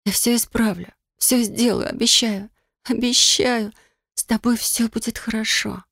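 a hushed, pleading vow; tearful promise. A narrator's reading. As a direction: soothe him through tears. Weeping over dead lover.

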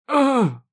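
high, loud scream of pain; piercing scream. A narrator's reading. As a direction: scream out as if stabbed. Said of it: "раздался пронзительный крик отца". (пронзительный крик боли)

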